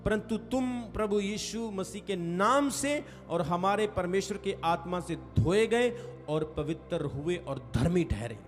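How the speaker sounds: ordinary talk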